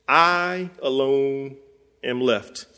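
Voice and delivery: I... alone, drawn out and heavy; slow stressed I alone. Shown as a demonstration. Preacher repeats the lament slowly for the congregation. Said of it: "Did y'all catch that?".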